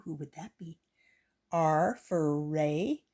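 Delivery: ordinary delivery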